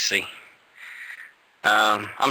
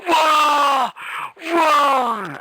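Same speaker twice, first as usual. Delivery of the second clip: high, loud real whoops; excited whoa; 4 dB louder than usual. Real, not acted. Wrestler psyched about his debut, loud high whooping. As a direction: let the thrill burst out.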